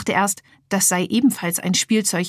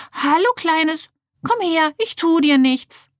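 put-on much higher, coaxing girl's voice, slowing at the end; 9 semitones higher than usual. Merle kneels and lures the piggy bank toward her, high coaxing. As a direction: coax a frightened animal gently.

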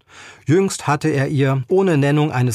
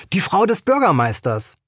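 pitched up, proudly announced; a bit higher; 7 semitones higher than usual. A narrator's reading. Woman claims to be the mayor's wife, voice pitched higher. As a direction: lift the voice a notch to sound important. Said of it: "sagte die Frau zwei Nuancen höher".

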